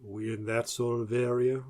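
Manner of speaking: a half-hearted stab at a Southern accent; failed Southern accent. Shown as a demonstration. Host trying a Deep South accent for American listeners, failing. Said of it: "I can't do it".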